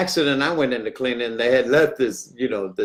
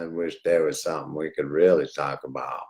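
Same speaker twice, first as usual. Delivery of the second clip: put-on old man's thoughts; 10 semitones lower, 5 dB quieter than usual. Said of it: "the old man's sitting there going".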